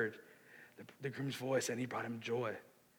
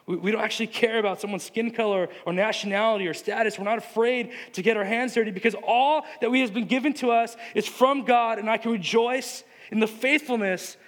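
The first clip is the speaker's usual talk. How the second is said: loud, higher-pitched sermon build; rousing, raised-voice proclamation; impassioned preaching; 7 semitones higher, 10 dB louder than usual. Real, not acted. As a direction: preach it at full force, driving onward. Preaches at full force.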